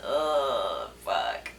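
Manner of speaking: imitating a low, dismayed groan; disgusted groan. Imitating boyfriend seeing her stained jeans, disgusted low groan. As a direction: drop low, softening on the curse. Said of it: "and he just went".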